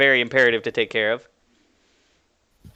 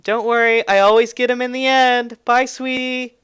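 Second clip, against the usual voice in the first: high-pitched sing-out to someone leaving; light, high mock shout; teasing shout; 10 semitones higher than usual. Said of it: "She shouts as you get away".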